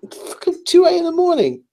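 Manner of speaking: high exasperated outburst; tired and fed up, pitch jumps up. Real, not acted. Tired retort about the late hour.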